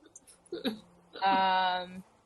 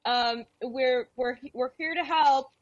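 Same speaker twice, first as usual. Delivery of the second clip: higher, friendly call while approaching; calling ahead; 8 semitones higher than usual. Acted. Beatrix telling Mary they are coming, higher. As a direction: announce yourself to ease her. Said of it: "I'm gonna say".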